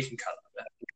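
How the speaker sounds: a whispered plea to cut the slip; whispered aside. Real, not acted. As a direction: drop to a whisper for the repeat. Repeats the request hushed.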